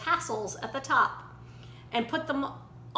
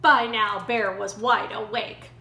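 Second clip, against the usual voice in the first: usual speaking voice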